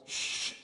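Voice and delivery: drawn-out shush imitating his dad; imitated loud shush. Son imitating dad hushing talk over music, stern shush. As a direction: shush them like a stern father. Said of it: "You'd get a loud".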